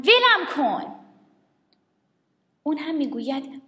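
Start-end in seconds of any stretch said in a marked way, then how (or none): 0.0-0.9 put-on girl shouting at her friend; yelled. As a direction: shout it, fed up.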